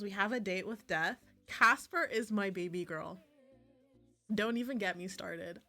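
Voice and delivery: ordinary delivery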